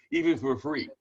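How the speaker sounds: usual speaking voice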